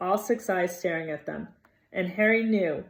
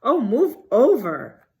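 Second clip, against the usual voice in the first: slow, loud, snarling impatience; 6 semitones higher, 10 dB louder, slower than usual. A narrator's reading. Hermione snarling at the boys at the locked door. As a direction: snarl it and shove past. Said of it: "Hermione snarled".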